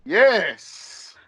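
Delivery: a single high, lightly voiced Russian-wrestler yes; in-character Russian yes. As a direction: answer in character as the big Russian. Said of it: "I'm like".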